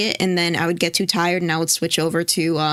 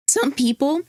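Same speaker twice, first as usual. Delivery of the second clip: voice cracking; 6 semitones higher than usual. Real, not acted. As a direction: voice cracks with emotion. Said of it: "my voice cracked".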